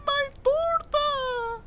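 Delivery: very high, drawn-out mock wail; exaggerated squeaky crying impression; imitated crying girl. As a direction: mimic a girl bawling over a loss. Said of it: "the girl who, uh, cried in the video".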